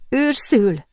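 high-pitched call to a friend; calling aloud through the wall. A narrator's reading. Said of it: "szólt fennhangon".

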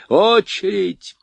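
loud, drawn-out battlefield order; shouted command. A narrator's reading. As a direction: bark the command from afar. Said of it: "донеслась команда с батареи".

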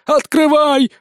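high, loud desperate yell. A narrator's reading. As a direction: bellow at someone to open up. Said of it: "заорал Сисюля". (орать во весь голос)

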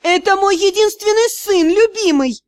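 high loud cry; exclaiming. Acted. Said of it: "воскликнула".

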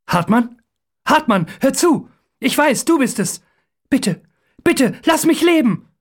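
high, urgent begging; hurried pleading. A narrator's reading. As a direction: plead quickly for your life. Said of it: "sagt er schnell".

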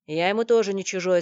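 loud defiant retort. A narrator's reading. Корецкий answers the warning about the prince, loudly defiant. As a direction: throw it out as a challenge. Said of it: "с вызовом бросил Корецкий".

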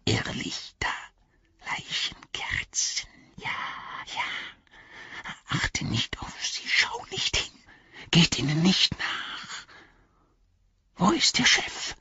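gehauchte, jammernde Kreaturenstimme; Gollum-Stimme. A narrator's reading. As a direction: wie ein ängstliches, lauerndes Wesen flüstern. Gollum als Figur gesprochen. (Gollum warnt Sam wimmernd und gehaucht)

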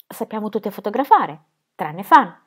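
usual speaking voice

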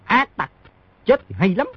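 high, gloating shout; gleeful bark. Acted. Smuggler gloats over the seemingly dead man, mock triumphant shout. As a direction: crow over the fallen enemy. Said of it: "Một người quát".

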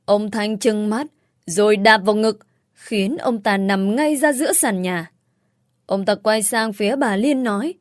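plain reading voice

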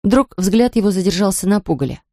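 plain reading voice

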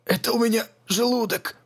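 high, strained cry of pain; old man in agony, straining to speak; squeezed out in pain. Acted. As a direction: force the word out through the pain.

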